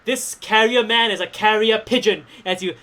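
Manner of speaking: usual speaking voice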